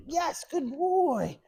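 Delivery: put-on high, stretched, bubbly good boy; excited praise. Shown as a demonstration. Trainer charging up the recall, high excited praise. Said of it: "We wanna be real excitable".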